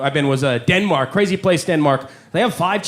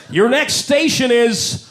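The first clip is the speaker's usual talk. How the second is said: mock subway announcer; 3 semitones higher than usual. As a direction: parody a metro PA. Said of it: "they do it in English".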